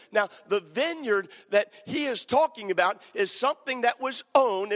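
ordinary delivery

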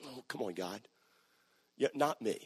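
a quiet, reluctant no; protesting quietly. Retold or imitated. Preacher quietly protesting God's call, soft reluctant voice. Arguing with God softly.

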